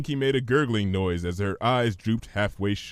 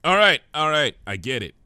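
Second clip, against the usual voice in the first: interrupting Twilight; 4 semitones higher, 4 dB louder, faster than usual. Acted. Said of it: "Twilight interrupted".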